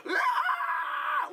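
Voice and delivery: put-on high piercing scream of a kid in a bear trap; imitated scream of pain. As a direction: shriek like your foot is caught in a trap. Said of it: "letting out that curdling scream".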